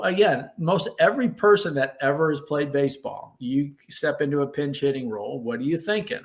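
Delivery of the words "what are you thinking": plain reading voice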